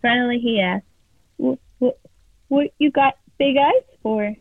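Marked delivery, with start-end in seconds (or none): 1.4-4.3 frightened stammer. A narrator's reading. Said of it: "The boy is so frightened".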